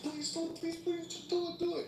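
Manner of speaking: high-pitched frantic pleading; begging. Retold or imitated. Someone begging you to harm yourself, high imitated pleading. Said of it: "someone's begging you to harm yourself".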